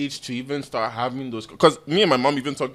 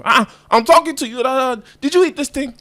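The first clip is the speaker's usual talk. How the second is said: high, insistent mock scolding; imitated yelling mom; 11 semitones higher, 4 dB louder than usual. Mom questioning child who walked off, mock yelling. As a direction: press the question like a scolding parent, pitched up. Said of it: "when she's yelling".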